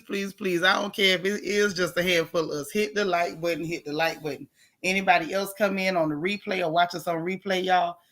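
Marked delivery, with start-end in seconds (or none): 3.6-4.5 softer and lower, almost a whisper; hushed. Shown as a demonstration. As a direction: hush it like late-night gossip. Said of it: "we gotta whisper while we gossip".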